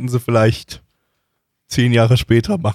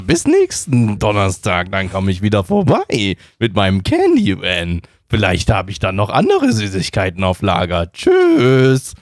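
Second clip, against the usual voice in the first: creepy candy man; 4 semitones lower than usual. Acted.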